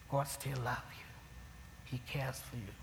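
softly whispered reassurance; tender whisper. Real, not acted. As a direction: lean in and whisper it to them. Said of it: "intimate reassurance to congregation".

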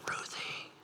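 soft whisper of a name in the night; hushed whisper calling a sleeper; God whispering a name. Retold or imitated. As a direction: breathe the name gently, like waking someone. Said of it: "he's gonna wake you up and he's gonna go".

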